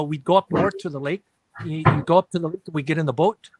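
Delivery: demonstrated Minnesota accent with rounded "boat". Shown as a demonstration. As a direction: talk like folks up north in Minnesota. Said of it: "You know, we say".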